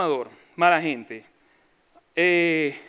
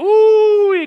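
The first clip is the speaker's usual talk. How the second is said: mock shocked yelp; 11 semitones higher, 11 dB louder than usual. Mock fear of evangelicals.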